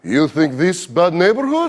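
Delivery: put-on slow, heavy Russian accent; fake Russian accent. Comic answering thugs in a fake Russian accent. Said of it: "fake a Russian accent".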